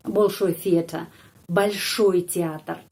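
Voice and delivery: slow Большой театр for dictation; slow model reading. Shown as a demonstration. Teacher reads the nominative slowly to students. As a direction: spell it out slowly. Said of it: "Itu nominatif".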